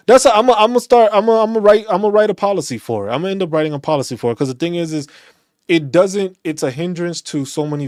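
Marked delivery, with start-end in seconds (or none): 0.1-2.8 loud, higher burst of resolve; fired up. Real, not acted. Veteran vows to write the policy, fired up and loud. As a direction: declare it with rising excitement.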